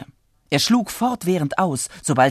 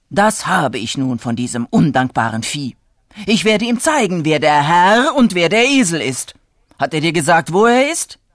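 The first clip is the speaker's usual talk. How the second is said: louder, rising acted anger; outraged farmer, voice climbing; indignant; 2 semitones higher, 6 dB louder than usual. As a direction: fume and build to a loud demand. Farmer enraged at the runaway donkey.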